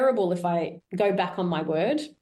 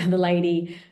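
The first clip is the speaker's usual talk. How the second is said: usual speaking voice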